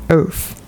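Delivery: "Earth" said as "erf"; British "th" as f. Shown as a demonstration. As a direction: end "Earth" on an f, no r. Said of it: "that's how us Brits say stuff".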